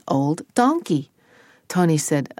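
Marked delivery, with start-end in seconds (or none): none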